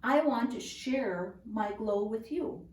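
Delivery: plain reading voice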